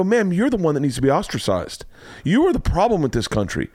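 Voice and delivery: high-pitched indignant comic rant; worked up. Retold or imitated. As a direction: scold them, pitch climbing as you go. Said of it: "I was so worked up".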